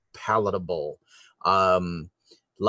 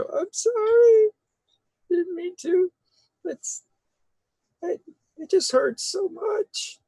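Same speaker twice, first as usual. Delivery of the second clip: tiny, very high whimper; sobbing baby voice. Acted. Baby man pleads as she runs away, sobbing baby voice. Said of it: "the sobbing baby voice go".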